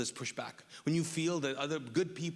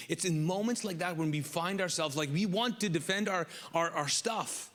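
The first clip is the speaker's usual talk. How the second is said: ordinary talk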